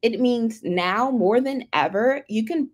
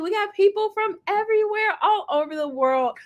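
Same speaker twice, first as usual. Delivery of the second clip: high-pitched, marvelling announcement; delighted excitement; 10 semitones higher than usual. Real, not acted. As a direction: marvel at the crowd from everywhere. Host delighted at audience's many locations.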